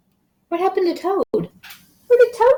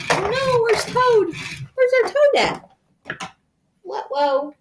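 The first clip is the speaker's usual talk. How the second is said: high-pitched playful dismay; mock alarm; 10 semitones higher than usual. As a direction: act worried for a child over a lost toy. Playful alarm over missing toad.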